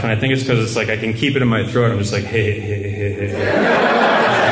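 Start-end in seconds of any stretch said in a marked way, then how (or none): 2.2-4.5 slow throaty heh heh laugh; Butthead laugh. Shown as a demonstration. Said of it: "I kinda have a Butthead laugh".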